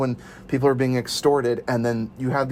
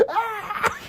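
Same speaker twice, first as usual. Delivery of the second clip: much higher laughing yelp; mock pain yelp. Imitating a character losing his arms, laughing yelp.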